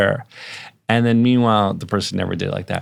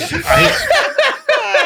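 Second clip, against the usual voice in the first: burst of laughter; 4 dB louder than usual. Real, not acted. Laughing at replayed clip.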